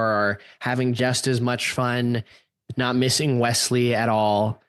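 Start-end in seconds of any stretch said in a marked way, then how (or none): none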